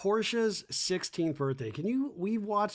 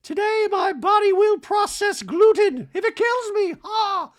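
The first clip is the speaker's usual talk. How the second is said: very high, loud, squeaky sing-song voice; hammed-up high Dorit voice; mock Dorit; 10 dB louder than usual. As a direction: gush it like a ditzy diva. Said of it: "Dorit's like".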